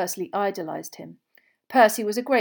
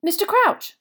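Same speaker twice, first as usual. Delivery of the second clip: high, louder, eager exclamation; excited; 13 semitones higher, 8 dB louder than usual. A narrator's reading. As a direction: blurt the name with eager awe. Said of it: "writhing with excitement".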